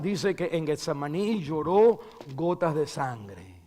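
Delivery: normal talking voice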